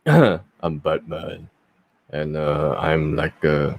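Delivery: low, slow Batman growl. Shown as a demonstration. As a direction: growl it like Batman, straining the throat. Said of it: "like the Batman one".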